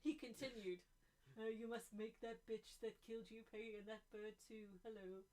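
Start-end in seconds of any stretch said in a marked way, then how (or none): 1.4-5.2 croaky frog king giving orders; sinister frog king, half laughing; Mister Frog voice. Acted. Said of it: "Mister Frog".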